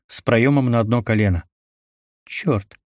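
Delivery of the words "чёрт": softer, wary hushed curse; fearful. A narrator's reading. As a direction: say it with fear. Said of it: "с опаской в голосе произнесла".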